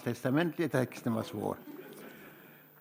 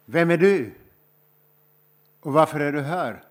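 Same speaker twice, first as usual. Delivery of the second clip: calling out; 3 semitones higher, 7 dB louder than usual. Retold or imitated. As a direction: call out sharply to a stranger on the road. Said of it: "någon som ropar".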